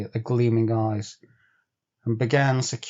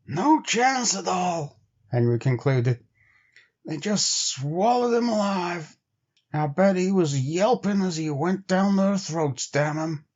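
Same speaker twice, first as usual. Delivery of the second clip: bitter trapper Henry; 6 semitones higher than usual. A narrator's reading. Henry telling Bill the wolves swallowed Fatty, bitter. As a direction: mutter bitterly about the lost dog.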